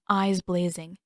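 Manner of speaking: ordinary delivery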